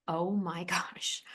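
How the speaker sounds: soft, unvoiced whisper with a small laugh; emotional whisper. Real, not acted. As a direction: let it slip out in a hushed breath. Overwhelmed recalling the moment.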